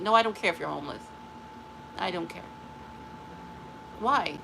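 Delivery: normal talking voice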